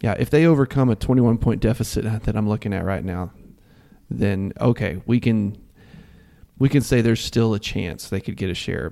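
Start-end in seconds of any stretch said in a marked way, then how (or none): none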